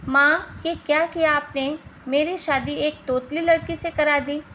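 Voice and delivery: usual speaking voice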